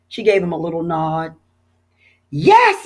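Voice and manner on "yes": high, loud eager yes; excited yell. A narrator's reading. Boys yelling yes to joining the rescue, excited yell. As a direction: shout yes to the ride. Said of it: "yelled, uh, Stanley and Arthur".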